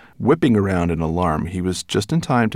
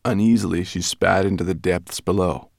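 plain reading voice

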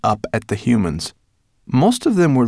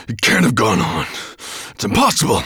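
frustrated dragon growl, louder; 2 semitones higher, 4 dB louder than usual. A narrator's reading. Dragon who has lost the scent, growling. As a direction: growl in disbelief. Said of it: "growled Nettlebrand again".

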